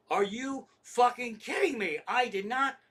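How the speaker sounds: high, loud indignant protest; irate caller who can't believe it; outraged disbelief. Retold or imitated. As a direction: protest hotly, pitch climbing. Retold furious reply to supervisor.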